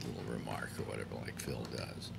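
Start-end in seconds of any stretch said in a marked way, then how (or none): none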